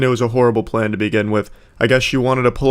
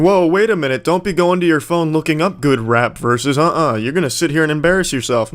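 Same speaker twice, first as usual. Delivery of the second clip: higher-pitched mock objection; intervening host; 4 semitones higher than usual. Guy cutting off rapper's phone stalling, higher mock protest. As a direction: imitate someone stepping in to stop her. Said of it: "the guy tried to step in".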